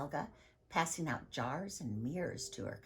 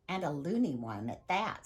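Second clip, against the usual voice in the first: ordinary delivery, nothing marked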